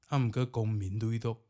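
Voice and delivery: ordinary delivery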